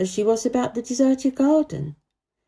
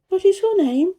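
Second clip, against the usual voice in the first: put-on much higher, a demanding little girl; little girl Mary; 11 semitones higher, 4 dB louder than usual. A narrator's reading. Mary asking the gardener, high child voice. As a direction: pitch up into a sharp child's voice. Mary's voice.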